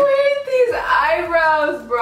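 high, drawn-out dismay; appalled and stretching each word; amused horror. Real, not acted. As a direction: wail it slowly on the edge of laughter. Appalled by her eyebrows in mirror.

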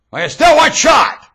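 loud, slow and defiant; defiant shout. Real, not acted. Ranting speaker, fed up with himself, shouts defiantly. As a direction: throw it out loud like a dare.

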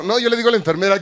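normal talking voice

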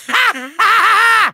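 evil cackle. Acted. Villain mocking the trapped Skylander, gloating cackle. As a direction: cackle in triumph. Villain mocks weakling heroes.

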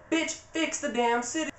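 high-pitched, put-on squawk of frustration; mock exasperated demand. Anna demanding her sister undo the freeze, in a high mock-exasperated voice. Acted quarrel between the sisters.

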